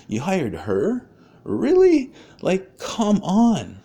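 high-pitched, drawn-out incredulous protest; retold indignation. Man confronting chief and council over a hiring, indignant disbelief. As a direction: stretch it out in mock-outraged disbelief. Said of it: "a piece of my mind".

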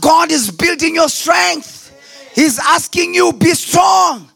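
loud, fervent preaching with rising pitch; full-force preaching. Acted. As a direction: shout the promise like a pastor at full force.

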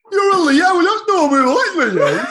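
high, loud, hammed-up Brummie; exaggerated laughing Brummie parody; mock Brummie accent. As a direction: mimic broad Birmingham, cracking up. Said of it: "that was brummy".

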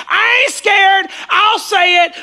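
shouted, high and loud; brash declaration yelled out; bold shout. Retold or imitated. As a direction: blurt it out fearless like Peter. Peter blurts answer boldly.

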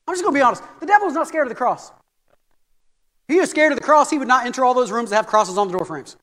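ordinary talk, nothing marked

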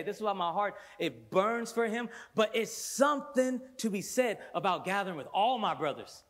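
loud, rising preacher's cry; fervent preaching. Acted. Pastor preaching to the church, fervent and rising.

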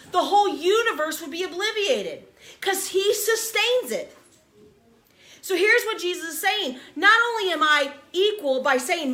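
fiery sermon climax; full-force preaching. Acted. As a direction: build the congregation up, pounding each claim. Preaching climax over music.

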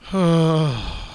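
long falling voiced sigh; drawn-out sigh of dismay; exasperated sigh. Real, not acted. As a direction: groan out a heavy sigh. Groans at disliked band being listed.

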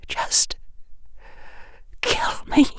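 high breathy whisper, near tears; tearful whisper. A narrator's reading. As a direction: whisper it through sobs. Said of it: "whispered Bellatrix".

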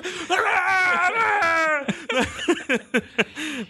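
high crying laugh; shrieking laugh. Real, not acted. Laughing at echo joke. (gargalhada aguda)

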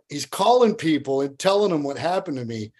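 usual speaking voice

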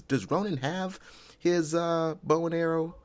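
high, puzzled question. Real, not acted. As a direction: ask it up high, unsure. Asking Dave a question.

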